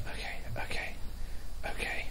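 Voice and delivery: whispered, soft reassurance to himself. Real, not acted. Scared player whispering to himself in the dark, whispering. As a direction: whisper it to steady your nerves. Calming himself while ghost hunting.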